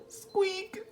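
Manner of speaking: a nervous little squeak; small squeak. Acted. Mouse squeaking at the sleeping lion, mildly high. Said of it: "Mouse peeped".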